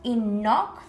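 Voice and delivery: ordinary delivery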